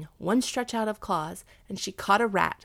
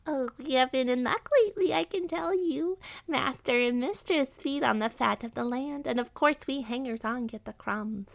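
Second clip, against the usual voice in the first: high rat voice, boastful; chatty rat; 7 semitones higher than usual. Acted. Rat telling the cat about the household's luck, chatty. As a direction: confide your good luck as a high-pitched rat.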